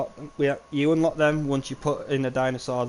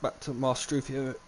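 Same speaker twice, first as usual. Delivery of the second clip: ordinary talk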